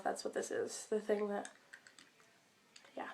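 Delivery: whispered through a cough drop; breathy aside around a lozenge; mouth full. Real, not acted. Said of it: "cough drop in my mouth".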